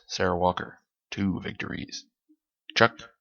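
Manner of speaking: usual speaking voice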